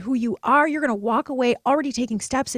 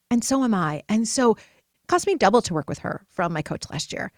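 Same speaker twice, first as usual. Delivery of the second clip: ordinary talk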